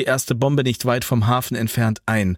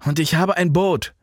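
higher, mildly winded delivery; lightly acted puffing urgency; out of breath; 7 semitones higher than usual. A narrator's reading. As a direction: blurt it while catching breath. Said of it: "schnaufte der".

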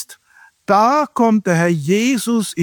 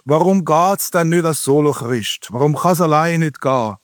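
Swiss German dialect, slightly lower voice; 4 semitones lower than usual. Shown as a demonstration. Preacher quoting an old Swiss song, in Swiss German. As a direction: quote it in homely Swiss German. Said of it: "Das gibt's aber nur in Schweizerdeutsch".

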